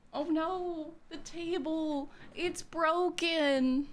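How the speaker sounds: high, slow mock wail; mock lament. Streamer plays up the breakage for chat, mock lament. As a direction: draw each phrase out in a high, theatrical lament.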